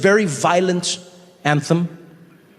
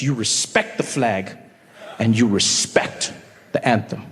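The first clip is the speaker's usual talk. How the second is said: solemn; 7 semitones lower, 4 dB quieter, slower than usual. Acted. Solemn rule stated reverently.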